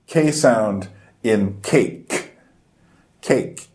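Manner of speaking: cake with a slow, hard aspirated K; K sound demo. Shown as a demonstration. Teacher showing the K sound of the word Cake, slowly. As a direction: stress the K in Cake slowly and crisply. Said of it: "there's two K sounds".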